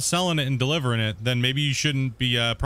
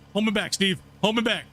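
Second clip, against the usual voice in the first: hammed-up hold me back; 6 semitones higher than usual. Mock fight pleading restraint.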